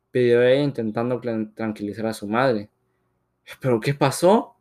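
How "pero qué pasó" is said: puzzled question, rising; very surprised. A narrator's reading. Husband confused by his wife's anger, surprised. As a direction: caught off guard, asking what happened. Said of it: "preguntó el señor muy sorprendido".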